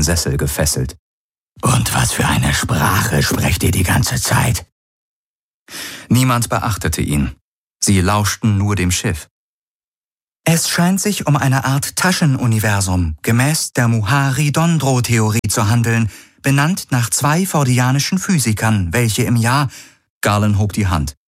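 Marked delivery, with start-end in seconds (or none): 1.6-4.7 low, slow, cold alien voice; low, measured voice of a bound alien who knows something; alien prisoner. Acted. Alien prisoner Gaurus speaks. 10.4-19.7 higher, even synthetic computer voice; high, neutral onboard computer reading out measurements; ship computer. Acted. Ship's computer lectures.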